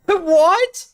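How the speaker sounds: high laughing What, incredulous; laughing disbelief. Real, not acted.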